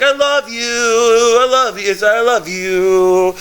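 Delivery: high, slow, gushing "I love you"; drawn-out adoring declaration. Retold or imitated. Retelling drummer's love song for his mom, gushing.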